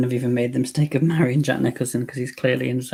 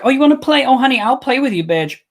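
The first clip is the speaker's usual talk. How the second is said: higher and louder, taunting and sassy; 10 semitones higher, 5 dB louder, faster than usual. Pretend. Imagining herself facing the killer, a sassy higher taunt. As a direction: purr a dare at the killer. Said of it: "I'd be like".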